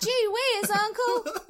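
put-on high, loud Deep South girl; Deep South accent. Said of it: "That's Deep South".

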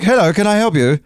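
high-pitched posh English greeting; posh English accent. Acted. Englishman offering help to stranded family, posh English accent. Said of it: "a posh English accent".

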